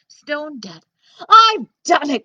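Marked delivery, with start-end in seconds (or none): 1.3-2.2 loud, high triumphant shout; triumphant cry. Acted. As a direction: shout it in victory. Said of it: "cried Jack".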